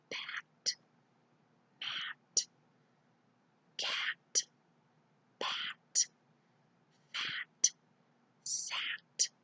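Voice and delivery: whispered word by word, very soft. Shown as a demonstration. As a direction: read each word in a hushed whisper. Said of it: "read them in a whisper voice".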